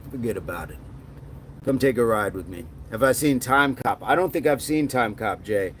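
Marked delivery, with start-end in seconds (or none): 0.1-2.7 low, hushed mobster voice; movie Italian accent. Pretend. Streamer playing a hushed movie Italian gangster inviting a ride. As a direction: menace it quietly like a movie gangster. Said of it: "another accent maybe I should work on".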